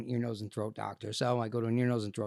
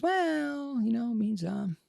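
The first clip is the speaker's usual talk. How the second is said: mock hesitant doctor; 12 semitones higher, slower than usual. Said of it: "And he goes".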